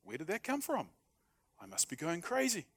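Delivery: puzzled at your own sudden tears; mock bewildered surprise. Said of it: "and you go".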